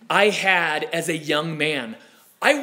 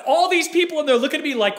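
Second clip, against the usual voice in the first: usual speaking voice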